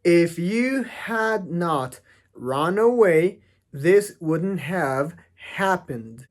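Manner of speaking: very slow and spelled out, had not in full; each word apart, slowly; slow unreduced textbook reading; careful full form. Shown as a demonstration. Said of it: "aquí no lo pronuncian de esa forma".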